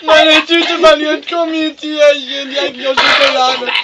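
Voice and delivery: high, loud, slow wail; hammed-up alarm. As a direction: wail it out in mock panic. Panicked cry over leaking bag.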